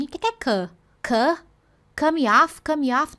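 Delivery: mock confused brain, rising questions; a lightly voiced confused learner; puzzled brain. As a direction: act out a confused listener. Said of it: "ele não fica assim".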